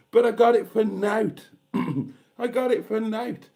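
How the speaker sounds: high-pitched crowing about a bargain; gleeful boast. Real, not acted. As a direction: exclaim it, thrilled with the freebie. Crowing about the free bowl.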